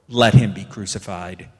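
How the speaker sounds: crowd shouting. A narrator's reading. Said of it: "they shouted all the more".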